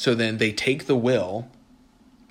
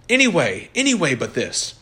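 loud, higher desperate plea; pleading protest; 5 semitones higher, 7 dB louder than usual. Acted. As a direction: beg him to stop, loudly. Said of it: "exclaimed the knight with impatience".

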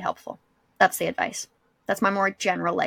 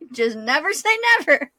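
high, laughing punchline; 12 semitones higher, 4 dB louder than usual. Real, not acted. Telling co-host about son's slumber party, laughing. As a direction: laugh through the irony of it.

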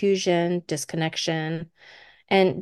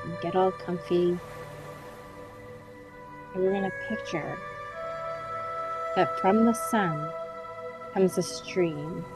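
guided meditation; 7 dB quieter than usual. Acted. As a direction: gently guide them into calm relaxation.

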